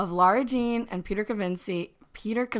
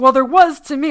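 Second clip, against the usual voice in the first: loud mock retort; 5 semitones higher, 11 dB louder than usual. Mean girl retort in retold quarrel.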